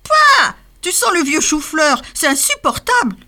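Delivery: pouah très aigu puis plainte ronchonne; râler, dégoûté; ronchonnement dégoûté. A narrator's reading. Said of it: "ronchonna Scrogneugneux". (le renne râle contre l'odeur de chou-fleur)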